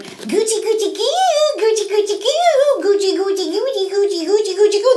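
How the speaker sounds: very high playful goochie-goo; tickling baby talk. Acted. The girls tickling the bear with playful baby talk. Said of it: "they went".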